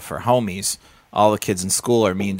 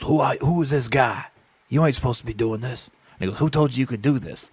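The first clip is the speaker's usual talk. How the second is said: lightly voiced gruff clown wrestler, higher pitched; 4 semitones higher than usual.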